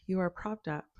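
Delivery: ordinary delivery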